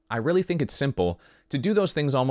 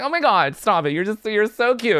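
high, loud mock gushing; flattered partner; 7 semitones higher, 5 dB louder than usual. Partner reacting to sweet talk, high and gushing. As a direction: gush bashfully, pitched way up.

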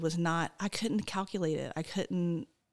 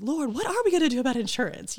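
fast, high, loud panicked prayer; retold frantic cry to the Lord; desperate plea; 8 semitones higher, 6 dB louder, faster than usual. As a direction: beg God in a rush of worry. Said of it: "And like".

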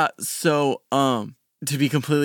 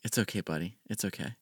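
put-on much softer, tender hush to a baby; gently calm the crying infant; soothing; 5 semitones lower, 14 dB quieter, faster than usual. Father comforting his crying baby son Clayton, softly soothing. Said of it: "kinda just be like".

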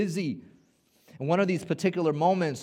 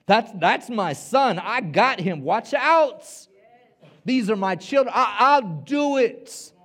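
fierce protective God; 6 semitones higher, 6 dB louder, slower than usual. Retold or imitated. God voiced in sermon.

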